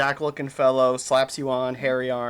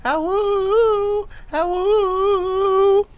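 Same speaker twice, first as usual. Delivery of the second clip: high falsetto ah-woo howl; howling like a wolf pup; 5 dB louder, much slower than usual. Shown as a demonstration. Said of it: "the high parts are gonna be more like".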